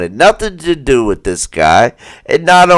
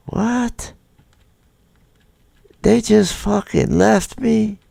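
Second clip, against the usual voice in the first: higher, softer imitated outrage at being ditched; mock disbelief; 6 semitones higher, 8 dB quieter, slower than usual. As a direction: voice the abandoned guy in disbelief.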